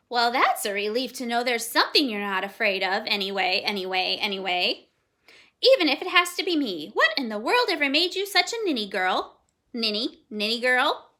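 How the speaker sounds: loud, repeating parrot chatter. A narrator's reading. Parrot teasing the fearful girl, loud chatter. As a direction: rattle it off like a bossy parrot.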